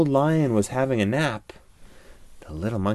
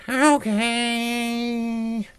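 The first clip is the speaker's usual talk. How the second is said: high little monkey agreeing; 13 semitones higher than usual. A narrator's reading. Monkey agrees to untie the lion, high and chirpy. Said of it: "And the monkey said".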